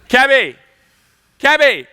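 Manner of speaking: loud call for a cab; shouting for a carriage. Acted. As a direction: hail the cab across the street. Hailing a carriage.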